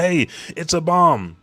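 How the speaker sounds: high, loud alarm call; shouted warning. Retold or imitated. Luffy spotting flames spiraling towards them, shouted warning. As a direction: call out danger in character.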